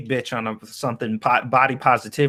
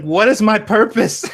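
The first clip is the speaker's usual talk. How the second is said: high, loud, slow lament with laughter; mock existential despair; 10 semitones higher, 6 dB louder than usual. Friend teasing Monkey for being lost, mock despair. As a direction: imitate someone comically lost, drawn out.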